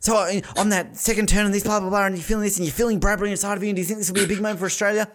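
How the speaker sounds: high, fast rambling reporter voice; gushing interviewer. Retold or imitated. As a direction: rattle off the question excitedly. Imitates a gushing interviewer's long question.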